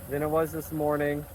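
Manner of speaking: loud and high, over engine noise; shouting over wind. Real, not acted. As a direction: raise your voice over the wind.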